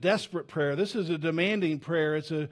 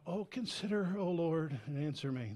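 softer, gently polite request, "consider" asked nicely; a polite, proper, softer prayer; mock polite; 8 dB quieter than usual. Shown as a demonstration. Pastor mocking the too-polite translation, softly polite. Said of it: "'cause it sounds polite".

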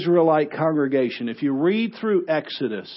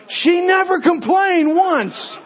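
higher, louder and slow, each word landed; punchline shout; 13 semitones higher, 4 dB louder, slower than usual. Retold or imitated. Stylist delivering the punchline to the other stylist, a slow loud high shout. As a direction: belt out the punchline.

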